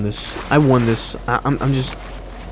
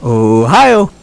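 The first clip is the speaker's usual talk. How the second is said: "Ohio" said louder in a put-on voice; hammed-up, louder "Ohio" that falls at the end; repeated impression; 3 semitones lower, 6 dB louder than usual. Replaying his own bad impression for viewers, louder put-on "Ohio". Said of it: "I gotta react to it every single time".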